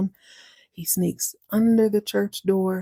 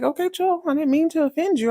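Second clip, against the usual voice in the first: higher, quick placating protest; defensive backpedal; 9 semitones higher, faster than usual. Acted. Mouse calming offended cat, high and defensive. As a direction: back off with hands up, pitched high.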